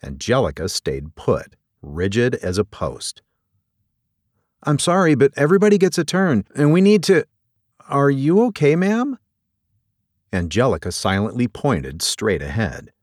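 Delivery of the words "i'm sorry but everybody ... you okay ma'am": high, polite docent losing her patience, then checking in; pert docent. Acted. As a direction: chivvy a visitor along, then ask with concern. Voices Kimberly, pert docent.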